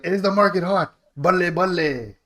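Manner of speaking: put-on loud, high Punjabi accent, lightly done; imitating a cheesy Punjabi realtor.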